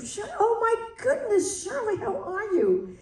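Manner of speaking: high, delighted surprise; light up at seeing her; running into Shirley in the lobby, pleasantly surprised and high; surprised greeting. Retold or imitated. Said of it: "He said".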